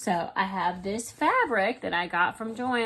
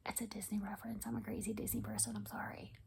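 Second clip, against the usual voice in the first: sheepish fast whisper, half to herself; a quick hushed confession about being a Disney fan; whispered aside; 13 dB quieter, faster than usual. Real, not acted. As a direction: confess it under your breath. Embarrassed aside to viewers.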